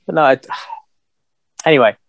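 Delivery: usual speaking voice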